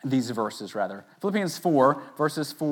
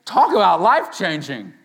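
lightly sarcastic gratitude; mock impressed listener; 6 semitones higher, 7 dB louder than usual. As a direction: play the unimpressed congregant thanking the pastor. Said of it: "And you're thinking".

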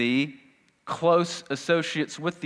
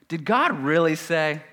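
slow, higher doubting question; lightly voiced serpent; 5 semitones higher than usual. Serpent questioning God's word in the garden, lightly voiced. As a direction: draw out the question, slightly put-on. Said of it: "the wicked one".

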